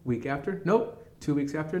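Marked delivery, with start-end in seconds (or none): 0.6-1.1 high, rising one-word brush-off; curt rejection. Retold or imitated. Hiring office answering an applicant's call, high-pitched brush-off. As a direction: pop it up high to dismiss the caller.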